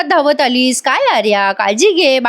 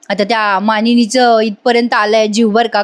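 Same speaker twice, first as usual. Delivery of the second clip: normal talking voice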